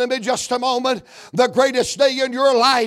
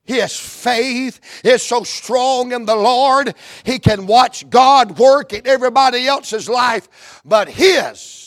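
loud, higher preacher's cadence; preaching build; 4 semitones higher, 4 dB louder than usual. Acted. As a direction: climb toward the verse with force. Preaching at full force.

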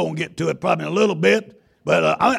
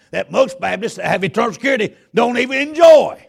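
full-force preaching; 6 semitones higher, 5 dB louder than usual. Acted. Pastor preaching to the congregation about the Holy Ghost, loud.

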